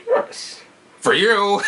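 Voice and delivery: put-on metal machine villain voice; mock mechanical villain delivery; Bane's voice. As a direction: speak as a big masked villain. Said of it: "saying it with his big, like, metal machine voice".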